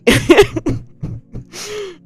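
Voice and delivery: high, loud amused laugh. Real, not acted. Teasing people who always trigger trauma, high laugh. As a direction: laugh at your own jab.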